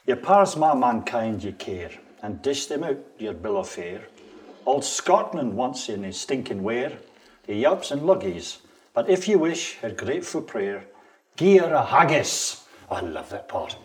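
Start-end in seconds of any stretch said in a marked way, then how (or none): 0.1-8.6 attempted Scots poetry recital; Scots accent. Shown as a demonstration. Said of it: "I'm gonna try in a Scots accent". 8.9-12.6 Scots verse, building to a loud, higher finish; Scots accent. Shown as a demonstration. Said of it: "I'm gonna try in a Scots accent".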